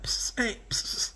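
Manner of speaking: whispered psst to get attention. Retold or imitated. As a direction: hiss psst like calling someone over. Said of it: "The people that's gonna be like".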